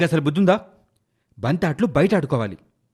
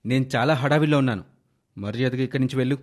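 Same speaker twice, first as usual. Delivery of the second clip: ordinary delivery, nothing marked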